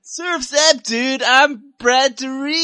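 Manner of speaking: high, loud surfer dude voice; stoner surfer dude. Pretend. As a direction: ham up a Californian stoner surfer. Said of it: "sounds like some Californian stoner surfer".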